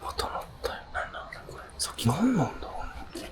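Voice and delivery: hushed, breathy whisper in the dark; spooked whisper on a haunted bridge at night; nervous whisper. Real, not acted. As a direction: keep it down so nothing hears you. Nervous at eerie noise on bridge.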